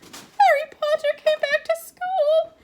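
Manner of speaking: high, slow, squeaky elf voice, hushed; a tearful house elf grieving; miserable whisper. A narrator's reading. House elf mourning over Harry, miserable squeaky voice. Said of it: "he whispered miserably".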